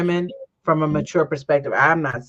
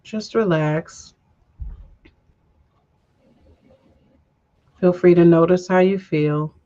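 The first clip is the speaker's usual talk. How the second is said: guided breathing. Acted. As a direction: lead the breath gently, unhurried. Leads a breathing meditation.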